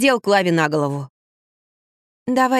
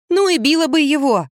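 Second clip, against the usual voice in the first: high, emphatic exclamation; indignant retort; 7 semitones higher than usual. A narrator's reading. Narrator to friend about the bullying mother, indignant. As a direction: toss it back with raised pitch.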